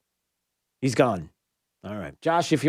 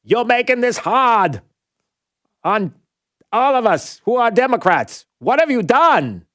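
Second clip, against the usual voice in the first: put-on poor Kennedy-style Massachusetts accent, pitched up like a podium speech; bad Massachusetts accent; 7 semitones higher, 4 dB louder than usual. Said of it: "I can't do a Massachusetts accent".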